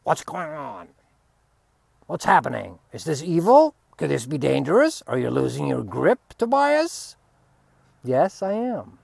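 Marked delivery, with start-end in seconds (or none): 0.0-7.2 mock panicky, louder inner voice; anxious mind voice. The fearful mind questioning him, louder and alarmed. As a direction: play the worried ego questioning. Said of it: "the mind's right there going".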